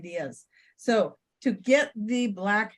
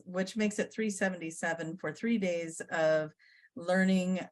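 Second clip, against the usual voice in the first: ordinary talk, nothing marked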